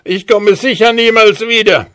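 very high, desperate dwarf plea. Acted. Dwarf promises never to come back, pleading. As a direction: beg to be freed.

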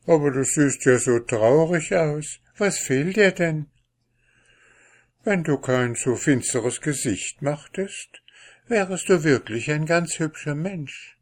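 high-pitched curious princess; princess, concerned. A narrator's reading. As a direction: lightly voice a princess asking after someone sad. (Prinzessin, hoch und teilnehmend)

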